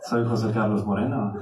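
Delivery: low, rolled-out Spanish name; suave self-introduction. Retold or imitated. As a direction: savor your own exotic name. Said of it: "I go like".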